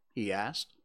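ordinary delivery

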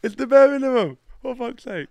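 words squeezed out through laughter; real laughter breaking up the words; laughing. Real, not acted. Laughing fit after the joke.

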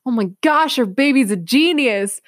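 dad marvelling at his daughter reading, excited; amazed dad. Retold or imitated. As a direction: gush like a dad stunned by his child.

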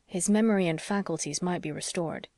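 ordinary delivery, nothing marked

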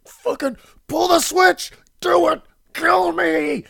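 very high, loud mock shriek; desperate demanding scream. Condemned man orders guards to pull switch, mock scream. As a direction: shriek the orders, fully hammed up.

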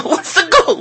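the goal question said again, laughing through it. Acted. Preacher mocking soccer to his audience, repeating his question while laughing. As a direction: laugh at your own question about the goal. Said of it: "You know what a goal is?".

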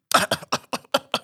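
a burst of staccato retching coughs; mock hacking cough; imitated coughing fit. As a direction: act out someone coughing it up. Imitates brother coughing up vomit.

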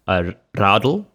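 radl said the Afrikaans way, rolled r; South African accent. Shown as a demonstration. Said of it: "I try to say that in an African accent".

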